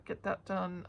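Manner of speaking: usual speaking voice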